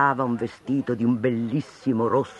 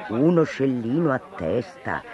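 ordinary delivery, nothing marked

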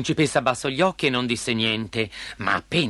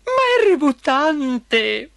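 very high, drawn-out whine; tearful protest; 13 semitones higher, 5 dB louder, much slower than usual. A narrator's reading. Princess to her father about the frog, tearfully. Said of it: "mormorò fra le lacrime".

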